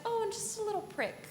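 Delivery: very high, soft, mock-innocent lilt; very sweet. Evil fairy showing off the spindle, mock-sweet. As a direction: make the threat sound dainty and sugary. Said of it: "she switches to be very sweet".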